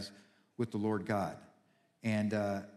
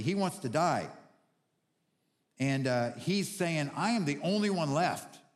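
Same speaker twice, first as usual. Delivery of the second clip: preaching with fervor; 5 semitones higher than usual. Acted. Pastor rallying the church about God in the cave, fervent preaching. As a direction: rouse the congregation. Preacher building to full force.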